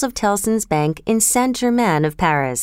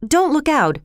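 higher, pressing warning; urgent warning call; urgent cry; 8 semitones higher than usual. A narrator's reading. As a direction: cry out to stop him. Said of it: "cried Mister Lorry".

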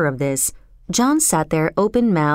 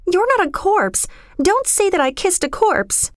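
put-on very high, distressed girl; pleading, aghast denial; horrified protest; 12 semitones higher than usual. A narrator's reading. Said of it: "she protested in horror".

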